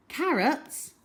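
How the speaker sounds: ordinary delivery, nothing marked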